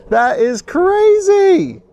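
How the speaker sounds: high, slow laughing disbelief; laughing in amazement. Real, not acted. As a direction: laugh through the words in awe.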